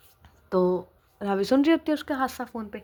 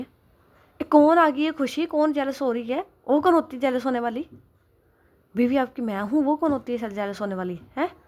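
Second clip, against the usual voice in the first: jealous outburst; 5 semitones higher, 4 dB louder than usual. Acted. Wife confronting husband about his coworker, jealous indignant outburst. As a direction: let the jealousy boil over, drawing out the first words.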